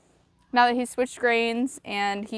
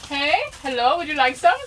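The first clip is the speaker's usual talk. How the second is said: bright sing-song greeting; chirpy server voice; 5 semitones higher, 3 dB louder than usual. Pretend. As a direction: offer it like a cheerful cashier. Drive-through for ponies game.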